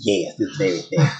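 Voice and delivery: low put-on Jersey accent; thick New Jersey accent. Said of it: "you have a New Jersey accent".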